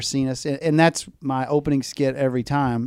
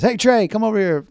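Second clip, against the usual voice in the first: loud, high call across the room; 8 semitones higher, 6 dB louder than usual. Retold or imitated. Coach calls the third baseman over, loud high call. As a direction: shout to get his attention.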